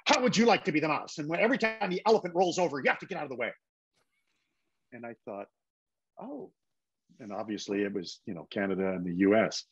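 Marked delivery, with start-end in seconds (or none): 0.1-3.5 quick, higher-pitched mock snap; retold snapping. Script supervisor snapping at an American, imitated irritation. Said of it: "she just turned and snapped and said". 6.2-6.5 small, high, soft oh; taken aback. Retold or imitated. Actor stung by supervisor's retort, softly taken aback. Retold stunned reaction to being snapped at.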